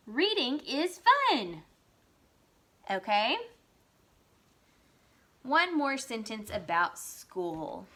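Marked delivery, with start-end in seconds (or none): 0.1-1.6 high and slow, enthusiastic exclamation; excited reading. Shown as a demonstration. Teacher repeating her exclamation sentence for pupils, excited. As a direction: stretch it out, pitched up with glee. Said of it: "But I'm excited".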